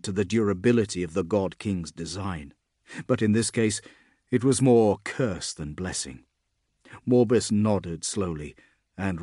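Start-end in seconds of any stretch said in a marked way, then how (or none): none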